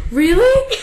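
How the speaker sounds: high, loud mock-innocent question; tears suddenly stopped. Sister imitating brother who stops crying, surprised little question. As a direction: imitate a bawling kid suddenly perking up. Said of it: "he stops crying and he's like".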